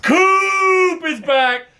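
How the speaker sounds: high, slow stretched shout; hype shout. Real, not acted. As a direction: yell it like a fan in the stands.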